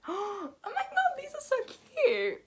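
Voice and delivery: high-pitched gushing after a gasp; real delighted squeal; excited. Real, not acted. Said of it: "I'm so excited".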